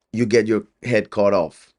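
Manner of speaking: usual speaking voice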